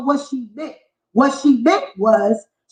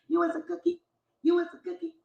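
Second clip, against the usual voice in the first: much softer sing-song jab; hushed taunt; 3 semitones higher, 12 dB quieter, faster than usual. Acted. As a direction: drop to a quiet taunt. Repeating the insult.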